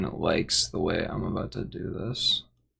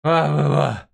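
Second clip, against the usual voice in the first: wah wah sound; 4 semitones higher, 5 dB louder than usual. Retold or imitated. Mocking sad trombone.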